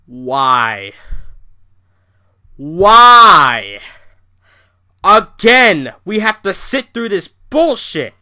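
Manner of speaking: outraged yelling at a rerun; frustrated yell. Retold or imitated. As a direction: blow up at the TV in frustration. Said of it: "I'm like".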